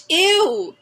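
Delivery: loud disgusted cry of ew. Real, not acted. Disgusted at lunch muffs.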